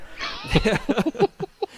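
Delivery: high laugh; real laugh; laughter. Real, not acted. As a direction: burst out laughing. Laughs at the tease.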